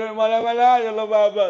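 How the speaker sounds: high, loud whining complaint; mock child's wail; crying child. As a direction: whine like a scolded child.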